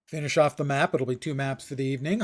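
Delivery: ordinary delivery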